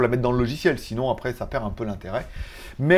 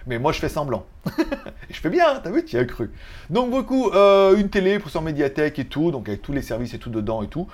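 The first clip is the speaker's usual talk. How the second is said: usual speaking voice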